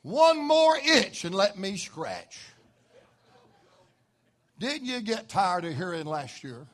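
ordinary talk

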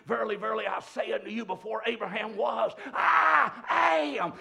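high, loud, shouted declaration; booming preacher's cry; thundering proclamation. Acted. Preaching Jesus' words at full force.

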